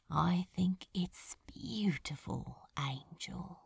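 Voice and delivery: soft hissing whisper, fading away; hissed whisper. Acted. Crowley to Aziraphale under the flickering lamps, hissed whisper. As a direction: breathe it out as a hiss. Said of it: "he hissed".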